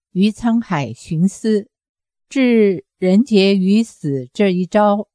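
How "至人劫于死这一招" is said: ordinary delivery, nothing marked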